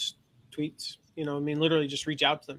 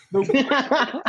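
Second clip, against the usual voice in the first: laughing; 8 semitones higher, 8 dB louder than usual. Real, not acted. Cracks up mid-sentence.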